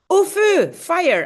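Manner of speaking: high, loud alarm cry; screaming fire. Acted. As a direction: call out fire in a panic.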